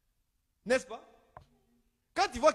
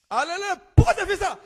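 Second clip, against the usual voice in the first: parent scolding; 8 semitones higher, 7 dB louder, faster than usual. Retold or imitated. Parent scolding daughter, acted.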